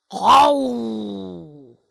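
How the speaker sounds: low, loud roar; lion roaring at the chameleon. Acted.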